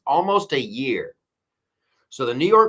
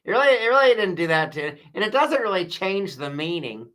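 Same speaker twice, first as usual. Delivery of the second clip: higher, louder whiny excuse voice; mocking defenders; 6 semitones higher, 4 dB louder than usual. Host mimics Kamala's defenders excusing plagiarism, whiny mock excuse. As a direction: play a defensive apologist brushing it off. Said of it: "And they're like".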